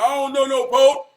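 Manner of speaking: loud high holler from far inside. Retold or imitated. The Father hollers back from inside, refusing, loud. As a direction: bellow it back without coming to the door. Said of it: "He just hollered about".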